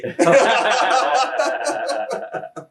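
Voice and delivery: bursting out in real laughter. Real, not acted. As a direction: let a big laugh go. Laughing at the end of the story.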